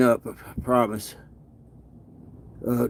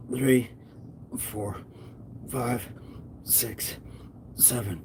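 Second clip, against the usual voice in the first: breathless, strained count between reps; counting through push-ups; 12 dB quieter, slower than usual. Real, not acted. Streamer counting his own push-ups, straining. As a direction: grunt each number out mid push-up.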